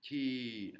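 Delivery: "Ti" drawn out, starting high and sliding down; falling tone. Shown as a demonstration. Teacher demonstrating the Thai tone mark, falling tone. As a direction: let "Ti" descend in pitch as it is held. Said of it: "ça descend l'intonation".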